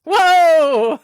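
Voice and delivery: real loud, drawn-out exclamation; loud startled whoa. Real, not acted. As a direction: belt out a surprised whoa. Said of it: "the whoa was really loud".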